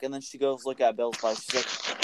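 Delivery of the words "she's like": ordinary delivery, nothing marked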